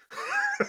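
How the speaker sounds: high laughing burst; a real laugh breaking out; burst of laughter. Real, not acted. Laughs at own story.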